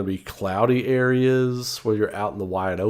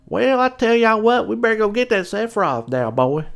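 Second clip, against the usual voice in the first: high, exaggerated Southern twang; hammed-up Southern cartoon accent; 11 semitones higher than usual. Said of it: "that's not a Southern accent".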